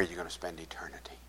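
a fast, urgent whisper; earnest whisper. Retold or imitated. Pastor pleading with Corey.

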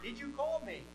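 hushed, high-pitched cue; whisper-quiet question for someone to repeat; soft prompting. Shown as a demonstration. Teacher feeding Samuel his question, hushed.

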